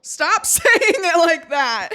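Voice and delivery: laughing protest at a creepy line; laughing plea. Real, not acted. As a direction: beg through laughter.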